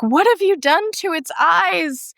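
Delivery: horrified cry. Retold or imitated. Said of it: "she's like".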